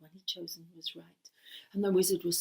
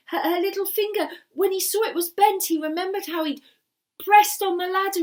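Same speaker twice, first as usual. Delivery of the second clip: building alarm; 9 semitones higher, 9 dB louder than usual. A narrator's reading.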